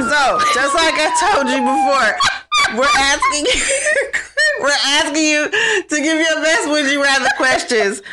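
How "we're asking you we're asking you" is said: laughing. Real, not acted. Laughs between repeated phrase.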